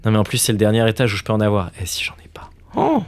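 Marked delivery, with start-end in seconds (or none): 1.8-3.0 whispered worry, swearing softly; real nervous whisper; anxious whisper. Real, not acted. Panicking about missing room.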